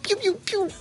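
high, soft pew pew pew; laser noises. Pretend. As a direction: zap like toy laser guns. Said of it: "I'll make the explosion noises".

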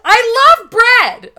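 high, slow bellow of love for bread; hammed-up gushing yell; mock Oprah shout. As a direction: shout it like a crazed ad star.

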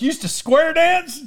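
high-pitched mock astonishment; incredulous. Grandchild to grandma about square dancing, incredulous. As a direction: question it in disbelief, pitch leaping up.

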